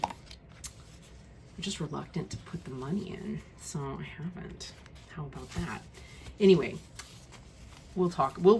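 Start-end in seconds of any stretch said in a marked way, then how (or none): 0.0-5.8 whispered, much softer admission; quiet confession. Real, not acted. Collector quietly admits she won't spend the money, whispered. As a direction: say it under your breath, a little sheepish.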